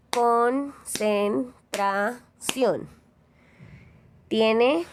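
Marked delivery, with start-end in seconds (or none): 0.1-2.9 "Concentración" in four very slow separate beats, con-cen-tra-ción; syllable by syllable. Shown as a demonstration. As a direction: break "Concentración" into syllables with clear gaps. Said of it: "golpes de voz".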